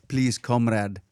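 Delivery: a quick Russian comrade plea; mock Russian accent. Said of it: "You're better at that than I am".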